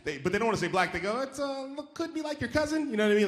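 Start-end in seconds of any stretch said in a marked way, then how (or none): 1.2-2.9 high, hesitant mock politeness; squirming, high-voiced careful hint; awkward euphemism. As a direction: tiptoe round the word, pitched way up. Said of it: "That's how they say it".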